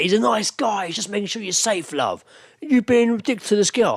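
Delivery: high, loud mock drunk bloke; drunk mate. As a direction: play the pushy drunk mate. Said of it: "his other mate was like".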